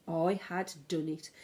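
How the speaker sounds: usual speaking voice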